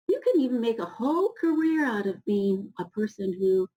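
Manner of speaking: ordinary delivery, nothing marked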